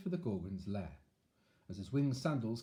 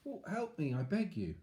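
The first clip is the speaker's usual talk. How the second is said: pleading; 7 semitones higher than usual. Acted. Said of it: "pleaded Perseus".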